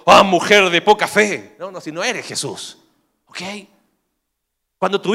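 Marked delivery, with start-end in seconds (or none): 0.1-1.6 slow, loud scolding sigh; mock rebuke. Husband mockingly rebukes his frightened wife, slow and loud. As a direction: lecture her mockingly. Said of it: "te dice".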